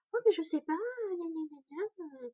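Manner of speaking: high, whiny mock excuse trailing off; mocking midwife. Mother mocks the midwife's careless excuse to the doctor, whiny. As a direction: parody her lame excuse with gna gna.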